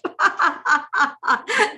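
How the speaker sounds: high, loud burst of laughter; amused laugh. Real, not acted. Host amusing herself with the sunset, amused laugh.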